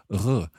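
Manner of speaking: R said as a French R; French-style R. Shown as a demonstration. Teacher showing listeners the waiter's accent, French-style R. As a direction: make the R in the throat, French-style. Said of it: "more like a French R".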